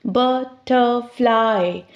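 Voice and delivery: "Butterfly" slowly in three beats, but, ter, fly; split into syllables. Shown as a demonstration. Teacher showing three syllables, "Butterfly" slowly split but-ter-fly. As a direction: break "Butterfly" into three parts with pauses between. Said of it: "three syllables".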